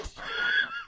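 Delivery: squealing high laugh; high laughing shriek. Real, not acted. Cracks up at own exaggeration.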